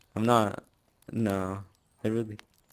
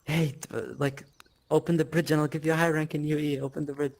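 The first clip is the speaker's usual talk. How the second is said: raised, higher-pitched mock yell; retold yell; 6 semitones higher than usual. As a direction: re-enact calling out urgently to someone, only half shouting. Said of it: "I was yelling, I was like".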